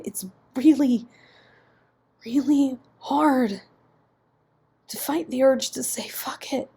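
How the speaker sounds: swinging from whispers to strained cries; anguished pleading. Acted. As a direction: confess it, fighting tears. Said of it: "in a choked whisper".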